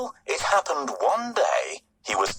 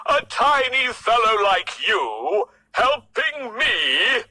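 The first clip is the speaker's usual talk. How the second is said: laughing loud scornful lion; scoffing lion laugh; 7 semitones higher, 4 dB louder than usual. Acted. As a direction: laugh off the tiny mouse.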